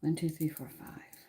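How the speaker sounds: counting softly under the breath, unvoiced; whispered counting. Real, not acted. Cook whispering the seconds while onions soak.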